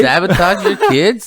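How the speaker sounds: high-pitched pretend disbelief; hammed-up indignant question; mock outrage. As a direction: act scandalized at a friend. Repeats the joking accusation.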